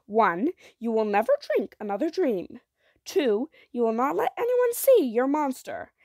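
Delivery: insistent, heavily stressed ultimatum; stern conditions. A narrator's reading. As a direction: lay down the rules forcefully, point by point. Mother lays down strict conditions.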